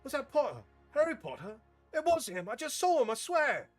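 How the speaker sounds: put-on a kid who just saw a celebrity; excited student. Excited student spotting Harry.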